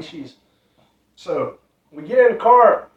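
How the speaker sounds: ordinary talk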